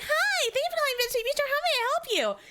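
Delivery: very high customer service voice; stressed head voice. Acted. Stressed host answering the restaurant phone, high head voice. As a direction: push the phone greeting up into head voice. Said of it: "speaking in my, like, head voice".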